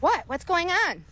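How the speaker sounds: put-on high-pitched mouthy kid; attitude. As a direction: imitate a kid giving attitude, pitch jumping up.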